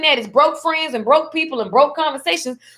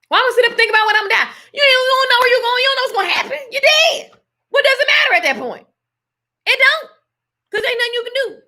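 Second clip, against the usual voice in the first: fired-up rant; 10 semitones higher, faster than usual. Real, not acted. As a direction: push it up, preaching hard about death. Heated rant about fearing death.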